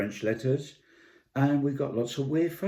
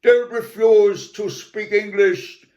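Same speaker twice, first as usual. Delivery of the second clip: posh Englishman; 11 semitones higher, 7 dB louder than usual. Retold or imitated. Posh Brian lecturing the French man, hammed-up high and loud. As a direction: play the snooty English teacher, way up high. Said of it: "the posh Brian coming in".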